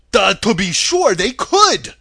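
drawn-out, loud goofy exclamation; Scarecrow. A narrator's reading. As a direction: cry out like a dim straw man. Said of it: "cried the Scarecrow".

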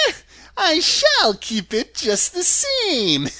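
high, slow witch voice ending in a cackle; gloating crone, drawn-out and high; witch laughing mockingly. A narrator's reading. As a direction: taunt loudly and high, then cackle. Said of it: "laughing at her".

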